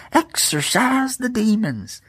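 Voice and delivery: high, slow hammed-up preacher voice; mocking the preacher's claim in a lofty voice. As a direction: ham it up like a televangelist casting out demons.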